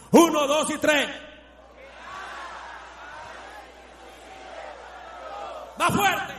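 high, loud rallying cry; loud countdown. Acted. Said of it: "usted lo va a gritar a todo pulmón".

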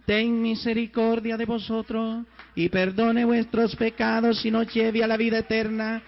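prayer proclaimed loudly to the congregation; loud liturgical prayer. Acted. Priest blessing the congregation, loud solemn prayer. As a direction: intone the blessing with full force.

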